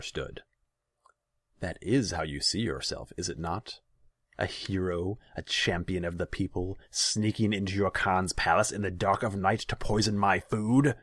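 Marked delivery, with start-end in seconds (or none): 1.6-11.0 building from sneer to shout; mounting fury. A narrator's reading. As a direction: rage rising to the end. Khan accuses Yala.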